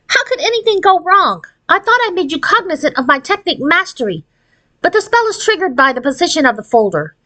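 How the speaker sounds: lightly voiced irritated fairy; Aurora the fairy, irritated. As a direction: talk down to the girl, a bit impatient.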